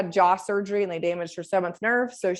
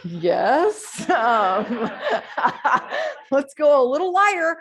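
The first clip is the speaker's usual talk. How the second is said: laughing through the words, louder and higher; laughing reply; 6 semitones higher, 4 dB louder, much slower than usual. Retold or imitated. To a patient overdoing forehead Botox, laughing. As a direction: chuckle while telling him to go lighter.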